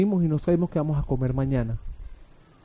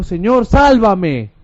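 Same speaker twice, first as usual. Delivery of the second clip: drawn-out cry for rescue, moderately raised; exclaimed plea; 5 semitones higher, 7 dB louder, much slower than usual. Acted. Preacher voicing Pedro sinking in the waves, exclaimed plea. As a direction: call out the plea, stretching the last word. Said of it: "exclamó y dijo".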